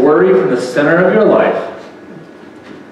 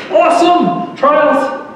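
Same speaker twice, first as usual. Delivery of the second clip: much higher, bursting with eager joy; giddy, laughing yes to every trial; excited new creature; 8 semitones higher than usual. Acted. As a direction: cheer it like trials are a gift. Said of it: "The new creature goes".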